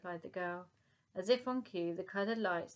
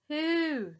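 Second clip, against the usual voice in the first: high, loud trumpeting hoot; loud mammoth who; 9 semitones higher, 8 dB louder than usual. Acted. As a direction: call out to the herd. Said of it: "cried Woolly".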